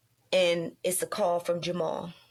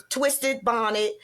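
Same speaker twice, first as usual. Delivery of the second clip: usual speaking voice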